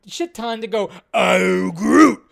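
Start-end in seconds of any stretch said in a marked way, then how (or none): 1.1-2.2 loud mock Groot voice, rising at the end; drawn-out, booming creature impression. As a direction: mimic a big movie creature's catchphrase, booming. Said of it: "Vin Diesel gets paid a shit ton".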